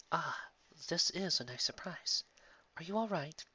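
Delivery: soft, slow, smiling welcome; Yarrow, gentle. Acted. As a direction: greet her gently, smiling. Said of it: "Smiling as he caught".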